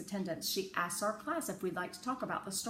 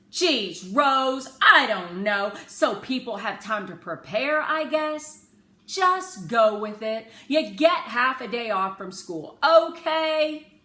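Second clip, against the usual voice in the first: exasperated father; 5 semitones higher, 13 dB louder, slower than usual. A narrator's reading.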